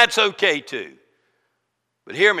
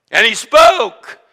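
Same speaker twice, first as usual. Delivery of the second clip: louder, higher, slow declaration; booming proclamation; 5 semitones higher, 9 dB louder, slower than usual. Acted. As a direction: lift it higher and slower. Preacher booming climax.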